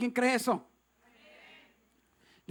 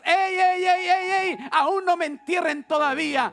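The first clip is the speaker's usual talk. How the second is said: raised, insistent preacher's shout; urgent defiant cry; 4 semitones higher, 4 dB louder than usual. Acted. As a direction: shout to halt everyone, rallying. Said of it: "te levantes en el nombre de Jesucristo y digas". (grito urgente y desafiante)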